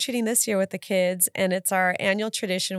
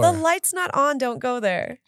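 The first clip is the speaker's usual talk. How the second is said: higher, scolding parent voice; 7 semitones higher than usual. Retold or imitated. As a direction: warn like a strict parent.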